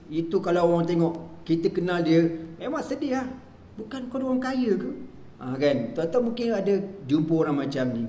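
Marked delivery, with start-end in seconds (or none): 3.8-4.9 incredulous. Retold or imitated. Incredulous question about rich friend.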